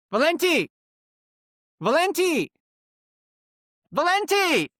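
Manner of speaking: high, loud mock yell of a name across the patio; retold scream. Stranger yelling a name at the pizza place, high loud shout. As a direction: call out the name like you're sure it's your buddy. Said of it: "he starts screaming at the top of his lungs".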